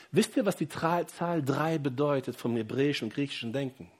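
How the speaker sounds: ordinary talk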